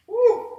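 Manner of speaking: high loud whoop; relieved whoop. Real, not acted. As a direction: celebrate the finish. Relief whoop after set.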